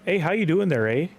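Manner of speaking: high, loud, hammed-up Canadian accent; a big cheery Canadian greeting in a Canadian accent. Shown as a demonstration. Streamer shows chat his quick Canadian accent. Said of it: "I can turn on a Canadian accent pretty quick".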